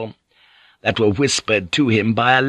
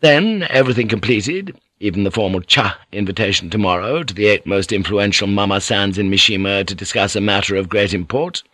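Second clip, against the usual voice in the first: plain reading voice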